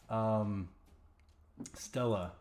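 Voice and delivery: ordinary talk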